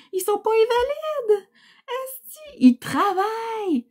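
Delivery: high-pitched exasperated exclamation; indignant outburst. Real, not acted. Defending her working adult kids.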